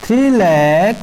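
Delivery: slow, chunk by chunk with long pauses; slow dictation. Shown as a demonstration. Teacher dictates the number for children to write, slowly. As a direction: spell the number out slowly while writing it. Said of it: "कैसे लिखेंगे?".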